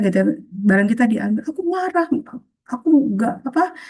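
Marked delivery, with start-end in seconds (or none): 1.5-3.8 mock angry outburst. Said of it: "emosinya sedang tinggi sekali".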